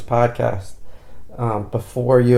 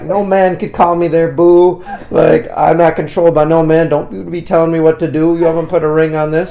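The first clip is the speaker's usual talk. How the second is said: mock-indignant sass, pitched up; Michelle; 5 semitones higher, 4 dB louder than usual. Boyfriend imitating how she said it, comic indignation. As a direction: imitate her protesting, higher and insistent. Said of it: "the way she said it was very funny".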